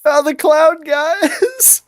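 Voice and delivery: high-pitched mock-alarmed call. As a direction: announce it high to your friends. Player calls out to the party.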